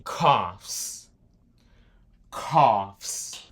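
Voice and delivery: coughs said as kofs, the final s kept audible; English plural demo. Shown as a demonstration. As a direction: model coughs for learners. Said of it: "Assim que você fala". (pronúncia de coughs com o s final)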